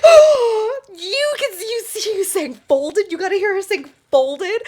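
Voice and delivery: thrilled, hearing the singer was already heard; excited disbelief. Retold or imitated. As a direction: gush in amazed delight. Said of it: "And I was like".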